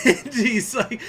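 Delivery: high, loud, laughing through the words. Real, not acted. Streamer laughs recounting the bitter poet, high and loud. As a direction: laugh as you start the line.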